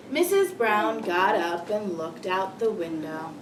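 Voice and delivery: slow, deliberate storybook reading; read-aloud to children. Acted. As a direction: read slowly to a class of young children. Rereads the ending aloud.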